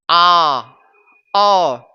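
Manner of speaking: आ and अ said loud with wide-open mouth; over-open "aa" for आ, then अ, each falling; mispronunciation demo. Shown as a demonstration. Teacher showing how some children say it, too-open vowel. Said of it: "जी नहीं".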